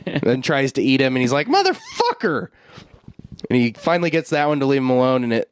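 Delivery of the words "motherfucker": startled yell. Retold or imitated. Ash yelling as the mimic book attacks, startled. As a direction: yelp it as the book lunges.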